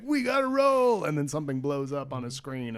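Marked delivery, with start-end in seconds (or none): 0.0-1.0 mock hero line, higher; hammed-up movie tough guy call; pitched-up mock urgent line; action hero urgency. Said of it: "and they're like".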